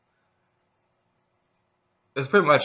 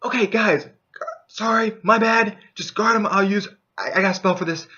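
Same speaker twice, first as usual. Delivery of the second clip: apologetic mage; 8 semitones higher, 3 dB louder than usual. Retold or imitated. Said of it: "the mage's like".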